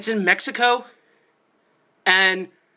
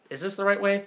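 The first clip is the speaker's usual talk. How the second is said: ordinary talk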